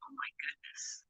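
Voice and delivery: a hushed, breathy oh my goodness; shocked whisper. Real, not acted. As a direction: whisper it in dismay at the weather. Reacts to freezing rain news.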